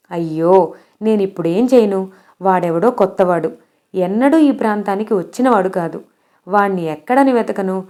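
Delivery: ordinary delivery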